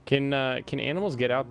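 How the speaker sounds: ordinary delivery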